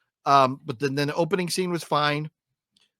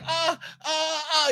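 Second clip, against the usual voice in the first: high, softer mock cries of pain; imitated dying cries; 12 semitones higher, 3 dB quieter, slower than usual. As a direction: ham up a character being eaten alive. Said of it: "he's going like".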